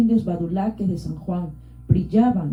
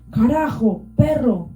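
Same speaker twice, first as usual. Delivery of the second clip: higher, louder furious yell; furious shout; 4 semitones higher, 3 dB louder than usual. A narrator's reading. Old Vilcas yells an insult at Pantacha, furious. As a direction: spit the curse at him. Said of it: "gritó".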